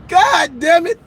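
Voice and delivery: laughing through a curse. Real, not acted. Comic laughing about the dirty litter box. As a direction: crack up and swear.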